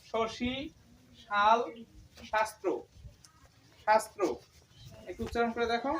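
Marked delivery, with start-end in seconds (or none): 0.1-4.2 শশী, শাল, শাস্ত্র with the শ said retroflex, tongue curled back; retroflex sh demo. Shown as a demonstration. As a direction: curl the tongue up for each শ. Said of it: "তালিব্য স এর উচ্চারণ". (মূর্ধন্য উচ্চারণে শ)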